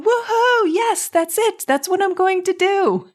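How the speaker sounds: mock jubilant whoop; mock excitement. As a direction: bounce up like a gleeful fan, clearly put on.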